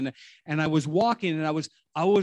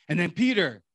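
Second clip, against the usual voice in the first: ordinary delivery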